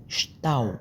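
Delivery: usual speaking voice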